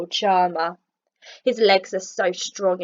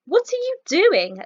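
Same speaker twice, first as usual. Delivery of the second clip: grinning leopard; 9 semitones higher, 5 dB louder than usual. A narrator's reading. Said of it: "Leopard asks with a grin".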